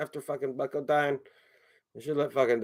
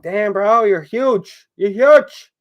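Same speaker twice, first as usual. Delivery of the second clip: high, loud exclamations, building; loud amazement; 9 semitones higher, 10 dB louder, much slower than usual. Acted. Owner to his oversized cat Duncan, loudly amazed. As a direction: marvel at the size, voice shooting up.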